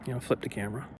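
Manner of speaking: normal talking voice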